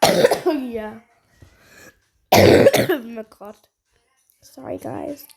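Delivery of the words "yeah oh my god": coughing through the words; real cough breaking into speech; coughing fit. Real, not acted. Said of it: "Sorry if you hear me coughing".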